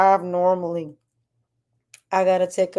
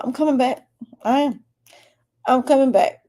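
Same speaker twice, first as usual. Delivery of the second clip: higher, eager reassurance to fans; excited promise; 7 semitones higher than usual. Real, not acted. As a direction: lift the voice, promising to return. Promising fans he'll return.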